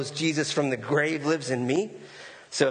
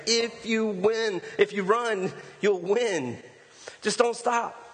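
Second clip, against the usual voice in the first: gentle urging voice; 6 semitones higher, slower than usual. Retold or imitated. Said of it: "And he's like".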